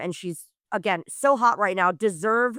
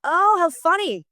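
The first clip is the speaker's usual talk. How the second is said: amused; 8 semitones higher, 5 dB louder than usual. Real, not acted. Reacting to business cas.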